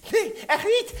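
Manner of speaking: high, loud squeaky protest; a little mouse insisting it isn't so; squeaky mouse denial. Acted. Mouse denying to Meis that he can talk, squeaky high protest.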